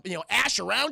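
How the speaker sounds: ordinary delivery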